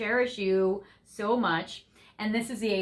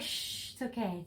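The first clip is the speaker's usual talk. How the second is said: soft whispered shush, then softer; soothing hush; 2 semitones lower, 11 dB quieter than usual. Pretend. Your person calming you down, soft soothing hush. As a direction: hush them gently like calming a pet. Partner soothing the angry one.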